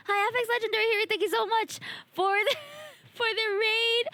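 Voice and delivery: high bubbly VTuber greeting; cute anime streamer. Real, not acted. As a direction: chirp the welcome like a VTuber. Cheerful greeting to raiding streamer.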